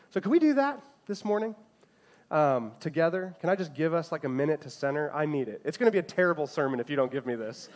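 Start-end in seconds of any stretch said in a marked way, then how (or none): none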